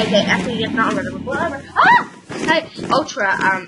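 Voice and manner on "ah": startled shriek. Real, not acted. Startled by something in game.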